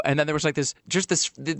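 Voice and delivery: ordinary talk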